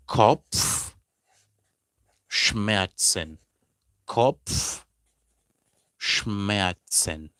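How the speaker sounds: Kopfschmerzen stretched very slowly, pf and tz spelled out; drawn-out German word. Shown as a demonstration. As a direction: model Kopfschmerzen syllable by syllable. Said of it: "You now say die".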